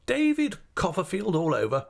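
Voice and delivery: high, emphatic exclamation; indignant high-pitched outcry; crying out. Acted. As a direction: exclaim it as an exasperated aunt. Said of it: "cried Miss Betsy".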